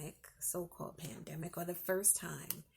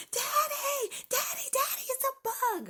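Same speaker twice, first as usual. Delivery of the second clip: put-on much higher, loud child's cry; panicked kids; 10 dB louder than usual. Children calling dad about the bug, mock panic. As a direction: wail like a scared little kid. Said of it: "the children are in there".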